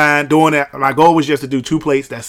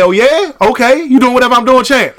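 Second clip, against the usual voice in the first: cocky taunt; 8 semitones higher, 3 dB louder than usual. Retold or imitated. Retells taunting gym stranger.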